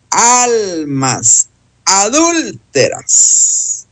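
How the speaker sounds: slow, drawn-out exclamation of amazement; demonstrating astonishment, word by word; astonished. Shown as a demonstration. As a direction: stretch each word in shocked wonder. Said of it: "Se pronuncia con asombro".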